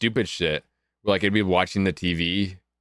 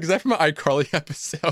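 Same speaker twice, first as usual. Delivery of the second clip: laughing mock question; 6 semitones higher, faster than usual. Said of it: "I'm like".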